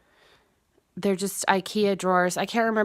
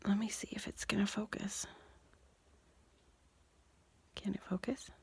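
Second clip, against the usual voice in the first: whispering; 15 dB quieter than usual. Real, not acted. Muttering while focusing the camera.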